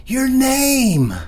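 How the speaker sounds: high, loud call down the hall. Acted. Mother calls after her son down the hall, loud and high. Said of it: "he hears his mama call".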